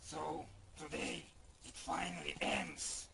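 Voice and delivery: low, quiet menacing villain; ominous villain. Acted. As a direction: say it darkly, softly.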